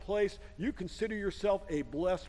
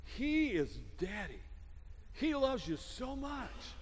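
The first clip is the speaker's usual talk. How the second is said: tender reassurance; 6 dB quieter than usual. Retold or imitated. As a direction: gently assure them, quietly. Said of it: "Jesus came along and he said".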